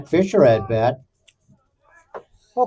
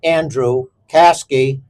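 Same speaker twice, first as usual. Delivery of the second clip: lineup announcer; 3 semitones lower than usual. Acted. Announces starting lineup formally.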